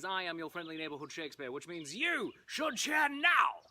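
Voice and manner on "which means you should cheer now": drawn-out NOW as a cue; cued cheer line. Shown as a demonstration. Performer cueing the audience to cheer, slow and drawn out. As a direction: stretch out "now!" to cue the crowd. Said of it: "When I say, "You may cheer now," I need you to go insane".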